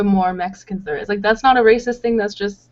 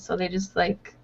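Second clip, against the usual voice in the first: usual speaking voice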